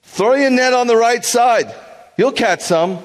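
high, loud call across the water; Jesus calling out. Retold or imitated. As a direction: call out to the boat from shore, high and slow. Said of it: "He said to them".

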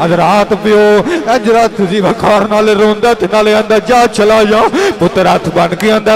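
high, loud impassioned sermon; full-force preaching. Acted. Preacher building to the climax of the story, full-force preaching.

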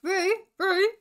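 high, loud searching call. A narrator's reading. Ferguson searches the forest for his friend, calling out. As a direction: call the name out through the trees. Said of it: "he called".

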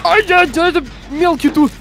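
panicked high yelp; man down shout. Real, not acted. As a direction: shriek it out in panic. Panicked in-game chase, high shout.

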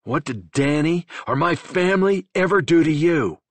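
high, loud furious question; enraged demand. A narrator's reading. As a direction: demand an answer in rage.

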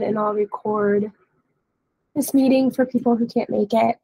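usual speaking voice

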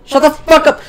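high, loud outburst; angry yell. Acted. Player shutting up a chatty character, angry yell. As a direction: cut them off with a shout.

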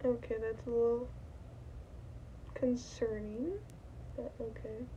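muffled through costume head; speaking inside mascot head; inside fursuit head. Real, not acted. Seen 0: Masked fursuit head worn over whole face throughout labelled words.

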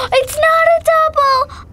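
high, loud excited cry; thrilled cry of relief. Real, not acted. Excited surprise.

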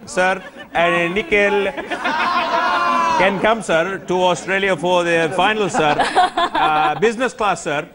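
high, put-on South Indian accent, every line ending in sir; mock South Indian accent. [name] asks Boria for a trip to the final, in a put-on South Indian accent. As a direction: play a cheeky South Indian fan begging a favour in the accent. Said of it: "with that beautiful South Indian accent".

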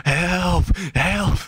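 high-pitched mock cry for help; half-hearted high "help"; mock scream for help. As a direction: call out for rescue without real volume. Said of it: "Midoriya just starts screaming out".

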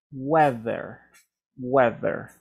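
Weather with th sounding like a d; teacher's model. Shown as a demonstration. Teacher repeats Weather for students, careful and clear. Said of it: "luego suena como una D".

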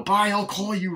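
high, loud hammed-up goodbye; mock call while fleeing. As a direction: toss it back over your shoulder while running.

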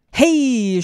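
loud, high drawn-out yell; shouted greeting. A narrator's reading. Liam greets her at the door, shouting. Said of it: "schrie Liam".

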